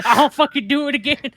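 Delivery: mock boast. Echoes the boast in high voice.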